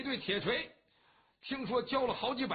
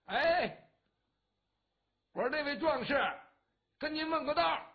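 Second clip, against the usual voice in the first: higher and louder, hailing a stranger; calling out; 6 semitones higher, 5 dB louder than usual. A narrator's reading. A passer-by hails the rider to ask the way, calling out. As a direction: call out to the rider from down the road. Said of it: "一看前面喊话".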